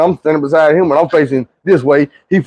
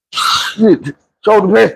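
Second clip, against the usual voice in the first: ordinary talk, nothing marked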